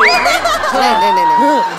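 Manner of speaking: very high squeaky no-no-no; hammed-up falsetto protest; squealed denial. Son hurriedly contradicts his father.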